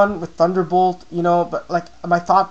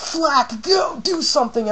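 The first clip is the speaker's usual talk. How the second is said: pitched way up, urging his Pokémon on; high urgent plea; 10 semitones higher, slower than usual. Retold or imitated. Player urging Flak during the battle, pitched high. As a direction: beg the Pokémon to act, high. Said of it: "And I'm like".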